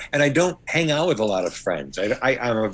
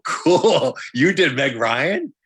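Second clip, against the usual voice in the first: high, loud, drawn-out delight; mock-impressed wife; 4 semitones higher, 5 dB louder, much slower than usual. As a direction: play a wife thrilled by the news, laughing. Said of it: "My wife would go".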